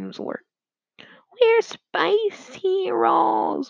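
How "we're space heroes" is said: high, long drawn-out whine; whining. A narrator's reading. John objects to the villain's threat, whining. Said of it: "whined John".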